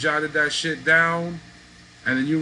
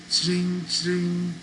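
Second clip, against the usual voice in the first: coin cup jingle; 6 semitones higher than usual. Retold or imitated. Mimics beggar shaking coin cup.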